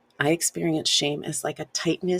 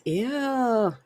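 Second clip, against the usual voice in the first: disgusted ew; 7 semitones higher than usual. Shown as a demonstration. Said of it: "thought comes".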